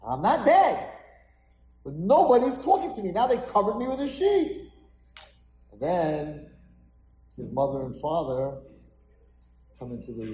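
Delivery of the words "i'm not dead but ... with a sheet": high, loud acted protest; indignant boy. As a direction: burst out because nobody will answer. Boy protests under the sheet.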